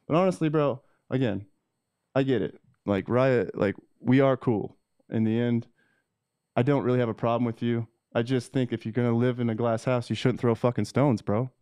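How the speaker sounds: normal talking voice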